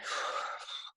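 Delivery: acted anxious exhale; imitated panicky sigh; nervous heavy breath. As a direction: blow out a heavy, voiceless breath. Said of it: "heart racing and breathing heavy".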